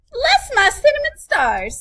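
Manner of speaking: high, loud exclamation of surprise; startled old woman crying out; old woman exclaiming. A narrator's reading. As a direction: squeal it out in delight. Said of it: "said the woman".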